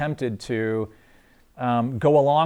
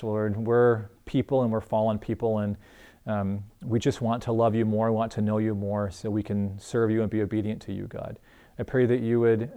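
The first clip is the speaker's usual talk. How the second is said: closing prayer; 3 semitones lower than usual. Acted. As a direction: pray humbly over the congregation. Closing prayer over the group.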